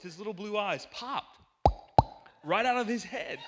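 ordinary talk

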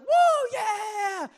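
high, loud whooping cheer; cheering crowd. Retold or imitated. Party guests cheering at gender reveal, loud high whoop. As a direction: shout for joy at the reveal. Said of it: "everyone's like".

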